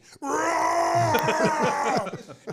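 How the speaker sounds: long, high, loud mock roar; comic drawn-out battle cry; imitated charging roar. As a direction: roar as you charge, hammed up. Imitates opponent charging at him.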